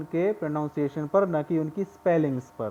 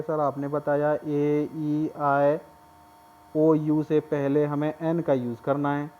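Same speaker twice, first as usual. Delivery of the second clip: ordinary delivery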